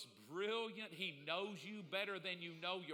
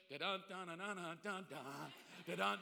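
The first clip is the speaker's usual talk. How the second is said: hummed fanfare; 3 semitones lower than usual. Retold or imitated. Imitating a reveal fanfare.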